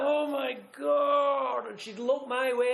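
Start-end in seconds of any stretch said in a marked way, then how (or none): 0.0-1.7 slow, stretched-out "Oh my God"; drawn-out exasperated groan. Retold or imitated. Fed up waiting at the bar, slow exasperated groan. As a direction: drag it out like you're at the end of your rope. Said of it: "and I'm like".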